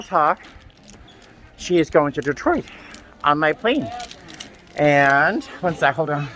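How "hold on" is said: ordinary delivery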